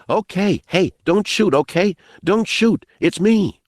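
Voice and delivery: put-on high-pitched boy's call. A narrator's reading. Boy begging gunmen not to shoot, calling out. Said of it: "in a loud call".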